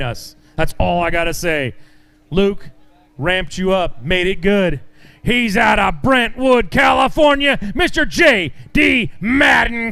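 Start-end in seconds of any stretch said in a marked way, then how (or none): 5.2-9.9 hype introduction. Acted. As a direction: build the crowd up for the next angler. Announcer introducing angler to crowd.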